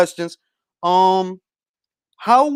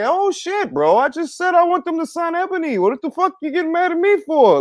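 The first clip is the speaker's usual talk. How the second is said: pitched up high, incredulous and fed up; exasperated mock reply; 8 semitones higher than usual. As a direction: act baffled at the angry guys, voice jumping up. Mock reply to angry Twitter guys.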